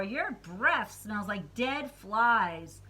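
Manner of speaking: slow mock disgust. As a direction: drag it out in disgust. Doctor reacts to bad breath.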